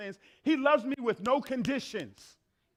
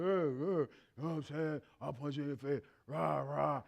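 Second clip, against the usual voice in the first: tough guy; 8 semitones lower, 6 dB quieter than usual. Retold or imitated. Mocking tough guy posturing.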